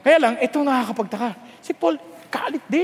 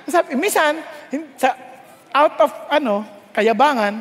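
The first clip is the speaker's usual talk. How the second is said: usual speaking voice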